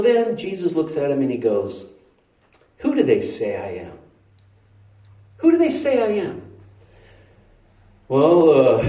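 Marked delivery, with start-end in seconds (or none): 2.8-4.0 low-pitched, grave question; low, measured question. Acted. Jesus asks, low first take. 5.4-6.4 high-pitched repeated question, falling at the end; high, insistent question. Acted.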